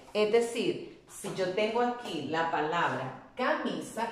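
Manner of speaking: ordinary delivery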